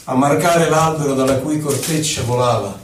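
ordinary delivery

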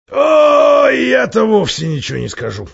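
high, loud outburst from a put-on dwarf; gruff-mannered dwarf, emphatic. A narrator's reading. Dwarf answering when asked his opinion, emphatic and high-pitched. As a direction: play the dwarf loudly protesting, pitched well up.

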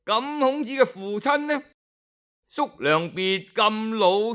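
plain reading voice

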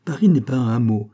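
ordinary delivery, nothing marked

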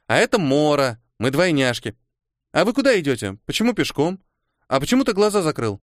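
put-on chatty little girl. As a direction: fire questions like a curious child. Narrator voices chatty girl Kora.

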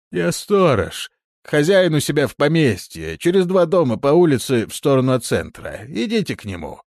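high, loud grumpy watchman; gruff watchman. A narrator's reading. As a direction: voice a surly old guard. Watchman voiced by narrator.

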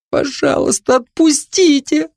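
put-on high, frightened girl's voice; helpless plea. A narrator's reading. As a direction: beg weakly to be let go. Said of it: "обессиленно, словно попавшая в паутину бабочка, прошептала Рози". (жалобная мольба высоким голосом)

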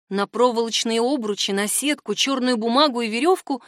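usual speaking voice

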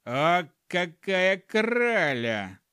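slow, high, drawn-out admiration; admiring gnome. A narrator's reading. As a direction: marvel slowly in the gnome's put-on voice. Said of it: "тихо сказал гном".

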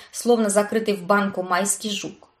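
ordinary delivery, nothing marked

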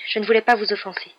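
plain reading voice

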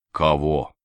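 low, dazed question; dull, blank. A narrator's reading. Narrator dazed by the events, asking dully. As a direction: ask it blankly, with no comprehension. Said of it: "тупо спросил я".

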